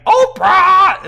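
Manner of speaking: very high, very loud screech; piercing screeched call. A narrator's reading. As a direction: screech the name at full volume. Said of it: "in a screeching voice".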